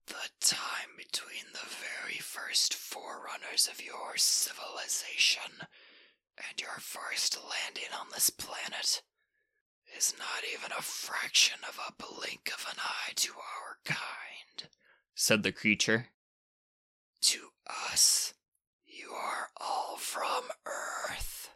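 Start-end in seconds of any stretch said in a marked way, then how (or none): 0.1-14.7 slow rasping whisper of an ancient creature; ancient dusty rasp. Acted. Ancient creature warning the boy, slow rasping whisper. 17.2-21.5 slow rasping whisper of an ancient creature; ancient dusty rasp. Acted. Ancient creature warning the boy, slow rasping whisper.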